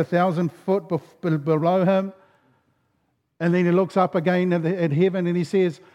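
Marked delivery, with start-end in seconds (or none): none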